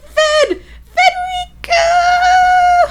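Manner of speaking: high, drawn-out mock scream. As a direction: scream the name in hammed-up fury. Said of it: "like, screaming and fighting".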